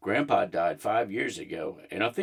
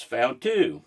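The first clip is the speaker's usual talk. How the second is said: mild shout; 3 semitones higher, 4 dB louder than usual. A narrator's reading. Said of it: "Amanda shouts".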